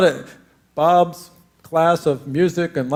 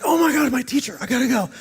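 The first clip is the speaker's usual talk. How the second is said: high, loud flustered panic; a late kid realizing he's in trouble; panicked rush; 8 semitones higher, 3 dB louder, faster than usual. Retold or imitated. Student realizes he is late for the teacher, panicked and high.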